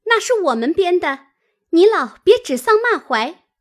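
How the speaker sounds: high, loud, quick retort; hurried objection. A narrator's reading. Girl cuts into the mother's abuse, hurried and indignant. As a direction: break in indignantly, pitched high. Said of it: "婴儿忙道".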